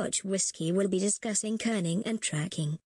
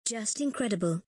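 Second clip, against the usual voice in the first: usual speaking voice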